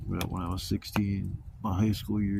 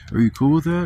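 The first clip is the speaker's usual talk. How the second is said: higher, louder playful question; mock nurse question; 6 semitones higher, 8 dB louder than usual. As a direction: voice the nurse's polite ask, pumped up. Nurse asking about breathalyzer.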